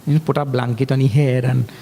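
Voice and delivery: ordinary talk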